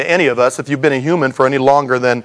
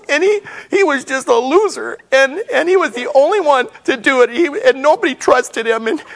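high, teary mock sobbing voice; crying voice; 11 semitones higher than usual. Preacher confessing he cries at movies, in a high teary voice. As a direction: let the voice crack high as if weeping at a movie. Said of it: "I cry in every movie".

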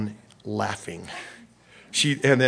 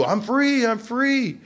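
gleeful youth; 10 semitones higher, slower than usual. Retold or imitated. Voicing teen's new freedom.

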